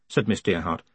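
usual speaking voice

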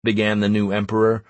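usual speaking voice

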